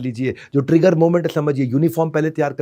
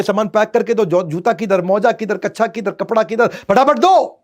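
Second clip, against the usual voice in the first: rising, louder, hurried rattling off of orders; frantic demands; 7 semitones higher, 5 dB louder than usual. Retold or imitated. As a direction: pile the demands on faster and higher. Husband's rushed morning demands. (हड़बड़ी में चिल्लाता पति)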